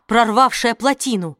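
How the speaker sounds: ordinary delivery, nothing marked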